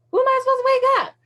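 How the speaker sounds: put-on high, fast whine of an impatient child; frustrated kid. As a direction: whine it up high and quick like a kid begging daddy. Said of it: "it was almost like a kid".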